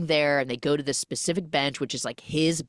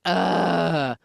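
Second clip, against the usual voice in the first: drawn-out mock groan; long retold "ugh" of dread; exasperated groan; 4 dB louder than usual. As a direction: groan at an unwanted call. Retold reaction to mom's phone call.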